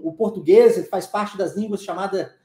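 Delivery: ordinary talk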